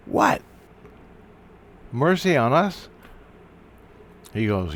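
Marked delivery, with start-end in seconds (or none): 0.1-2.9 high baffled echo; incredulous. Retold or imitated. Asking Christ and the priest about mercy, incredulous. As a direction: question it in disbelief.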